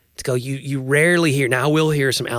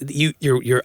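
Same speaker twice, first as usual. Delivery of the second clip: ordinary talk, nothing marked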